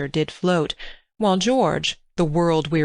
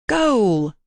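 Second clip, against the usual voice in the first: loud, high cry of goal; first goal call, already raised; shouted goal; 5 semitones higher, 3 dB louder than usual. A narrator's reading. Boys playing football in pool.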